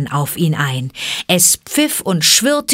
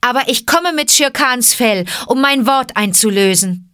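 high, raised, proclaiming voice; calling out; 6 semitones higher, 3 dB louder than usual. Acted. Mowgli bringing Shere Khan's hide to his mother, calling out. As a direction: call out the news of your deed. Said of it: "rief Mowgli".

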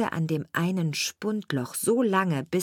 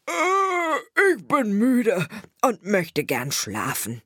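groaning, drawn-out yawny complaint, pitched up; sleepy dog; 4 semitones higher, 3 dB louder, much slower than usual. A narrator's reading. Dog telling his sparrow friend he is tired, sleepy groan. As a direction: drag the words out through a big weary yawn.

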